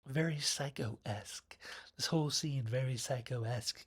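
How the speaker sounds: hushed spooky aside; whisper. Real, not acted.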